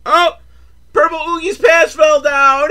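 high, loud taunting yell; gleeful mock shout. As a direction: shout it out like a playground jeer. Mocking shout over fallen pants.

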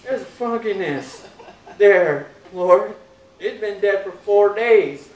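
loud, slow, laughing protest; comic Martha. Retold or imitated. Martha telling Jesus not to open the tomb, loud comic protest. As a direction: plead with Jesus, half laughing at the smell. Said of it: "in our language it's a funk".

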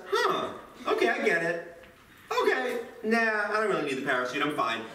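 dismissive Hannah. Pretend. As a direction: laugh off the parachute offer. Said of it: "Hannah says".

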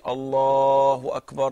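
loud, long-drawn shouted call; shouted demo. Shown as a demonstration. Teacher showing students what shouting the takbir is, shouted. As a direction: shout it out, stretching the first word. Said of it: "this is shouting".

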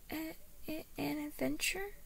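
put-on small, high child voice, hesitant; unsure. A narrator's reading. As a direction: stammer it softly like a doubtful little boy. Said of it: "he asked, unsure".

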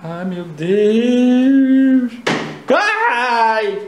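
high, very slow loud frightened wail; scared wail. Acted. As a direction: wail in terror at the noise. Scared by noise.

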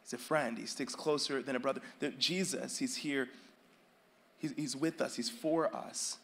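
soft, low, intimate preaching; quiet devotion. Acted. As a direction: speak it softly like a whispered assurance.

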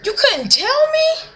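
high, slow disbelieving what; mock outraged shriek of surprise; shocked outrage. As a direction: act betrayed by the news. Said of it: "I'm like".

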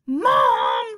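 a son screaming at his mother in the car. Acted. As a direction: scream for her to take your side. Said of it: "I screamed".

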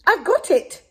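high, bright eureka cry; excited cry. Acted. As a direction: burst out with the idea. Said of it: "she cried".

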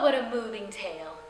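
usual speaking voice

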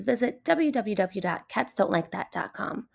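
usual speaking voice

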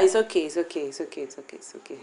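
low soothing fading into a whisper; hushed placating. Acted. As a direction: back down softly, trailing to a whisper. Backing down to soothe the other.

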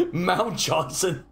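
slow, drawn-out caveman voice; mock caveman. Player joking the name is caveman mating instructions, mock caveman. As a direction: grunt it out slowly like a caveman. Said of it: "mating instructions from a caveman".

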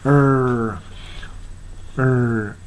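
"er" held with the r ringing; resonant r. Shown as a demonstration. Teacher isolating the rhotic ending, long r-coloured "er". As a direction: draw out "er" with a curled American r. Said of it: "Rが響く".

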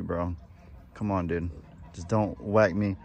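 normal talking voice

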